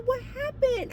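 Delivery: high, hushed concern; concerned questioning. Retold or imitated. Manager asking crying resident what is wrong, concerned.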